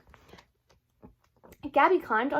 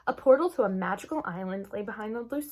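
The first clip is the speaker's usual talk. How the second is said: ordinary delivery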